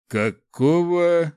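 high, loud, drawn-out surprise; startled and baffled; surprised. A narrator's reading. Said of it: "удивился он".

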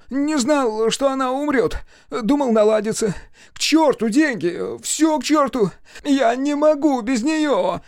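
high-pitched desperate outburst; anguished cry. A narrator's reading. Sam breaks down under questioning over his sister, anguished cry. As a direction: cry it out, losing all control. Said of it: "крикнул он".

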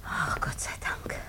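slow, voiceless whisper of relief; whispered relief after the news; relieved whisper. Acted. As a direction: sigh it out without voice. Relieved wife in hushed night talk.

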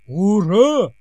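loud, high dutiful cheer; soldiers' hurrah. Acted. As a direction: shout it like soldiers on cue. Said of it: "дружно подхватили двое солдат".

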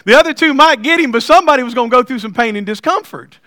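telling the wife. Retold or imitated. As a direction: lean in like it's obvious. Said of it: "I told my wife".

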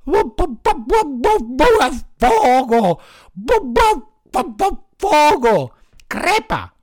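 high yelping dog voice, words broken by barks; high, loud dog shouting through barks; barking dog crying fire. A narrator's reading. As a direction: bark out the cry for help like a frightened dog.

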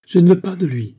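high, loud cry of protest; cried refusal. A narrator's reading. Daughter runs after father refusing the match, loud high cry. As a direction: call it out after someone leaving. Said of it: "cria Christine en courant après son père".

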